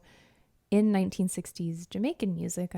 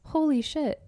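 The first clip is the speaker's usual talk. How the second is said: higher-pitched "whoa" of discovery; impressed, pitched-up exclamation; amazed reaction; 8 semitones higher than usual. Retold or imitated. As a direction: relive being stunned by a find. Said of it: "where I was like".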